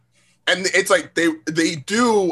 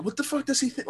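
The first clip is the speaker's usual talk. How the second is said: high, soft incredulous question; a disbelieving thought in your head; exasperated inner voice; 10 semitones higher, 8 dB quieter than usual. Retold or imitated. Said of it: "I was like".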